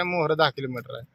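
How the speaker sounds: ordinary delivery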